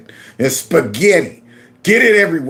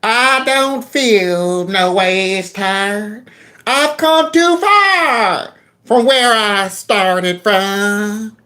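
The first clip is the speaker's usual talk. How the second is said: slow, stretched-out imitation of a Southern church cadence, pitched higher; mock church drawl; 3 semitones higher, much slower than usual. Mocking a politician's put-on church voice, slow stretched drawl. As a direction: drag every word out like a put-on preacher. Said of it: "do you think she talks like that normally".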